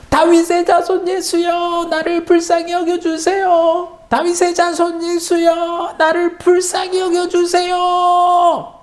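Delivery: very high, slow desperate wail. A narrator's reading. Blind man afraid the crowd drowns him, very high desperate wail. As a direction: wail it so He hears over the crowd. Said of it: "더 큰 소리로 울부짖었어요".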